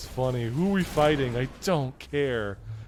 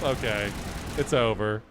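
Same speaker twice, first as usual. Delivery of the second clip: usual speaking voice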